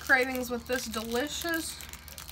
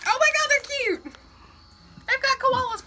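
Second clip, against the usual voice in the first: excited; 11 semitones higher, 4 dB louder than usual. Real, not acted. Gushing over cute koala snacks.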